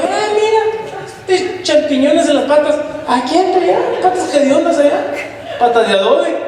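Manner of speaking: high, taunting voice breaking into laughter; laughing mock insult. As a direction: jeer about stinky feet while laughing. Mock insult about smelly feet.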